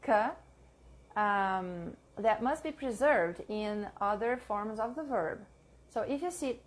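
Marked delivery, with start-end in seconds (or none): 0.0-0.3 "K" said as a bare hard consonant; the hard C of "K", as a sound rather than a letter name; short, isolated "K" sound. Shown as a demonstration. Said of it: "has a hard C".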